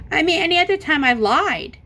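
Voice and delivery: a retold exasperated demand; exasperated insisting. Retold argument with officer over ticket.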